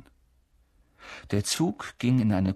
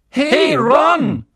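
much higher, louder call; 10 dB louder than usual. Acted. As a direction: call out across the train. Twin calls into the compartment.

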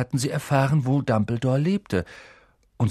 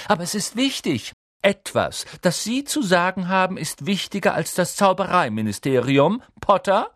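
high-pitched lofty reprimand; haughty, incredulous teacher; stern professor; 9 semitones higher, 3 dB louder than usual. A narrator's reading. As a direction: put the pupil in his place.

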